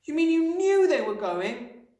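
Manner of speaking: put-on high, loud, slow child's voice; incredulous little girl, pitched right up; accusing cry. A narrator's reading. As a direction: cry out feeling betrayed. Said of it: "Matilda cried".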